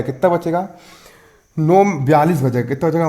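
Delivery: ordinary talk, nothing marked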